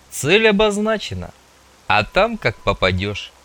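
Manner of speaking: mildly indulgent, starting high then easing down; benevolent. A narrator's reading. Rezany answers Mun about the target, benevolently. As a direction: grant it with a touch of condescending favour. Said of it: "благосклонно отозвался Резаный".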